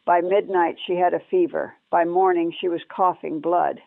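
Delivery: ordinary delivery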